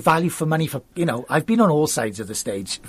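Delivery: ordinary talk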